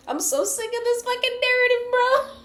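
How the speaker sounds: very high, whining squeal; mock exasperation. Reader fed up with the repeated storyline, squealed mock complaint. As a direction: squeal it, breaking into laughter.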